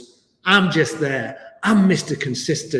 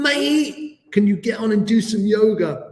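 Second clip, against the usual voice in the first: much higher, mock-exasperated listener voice; impatient viewer; 7 semitones higher, slower than usual. Teacher voicing an impatient viewer who wants yoga, mock exasperated high voice. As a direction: play the bored viewer begging him to get on with it. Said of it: "you're probably thinking".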